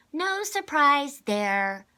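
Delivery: put-on high, loud, slow huffing little girl; huffy child. A narrator's reading. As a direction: harrumph it like an offended little girl. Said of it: "Constance humped".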